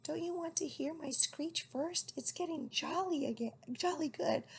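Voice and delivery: high eager owlet voice; keen little owl, pitched high. A narrator's reading. Owlet eager to show mother his screech.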